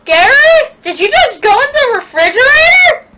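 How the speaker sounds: high, loud shocked shout. Acted. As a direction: shout in disbelief at what you saw. Mom shocked son vanished.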